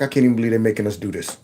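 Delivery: low, fast, soft grumble; muttered disbelief. Acted. Imagined Border Patrol agents grumbling privately, quick low mutter. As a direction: mutter it quickly under the breath.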